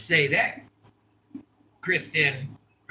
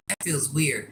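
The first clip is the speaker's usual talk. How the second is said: usual speaking voice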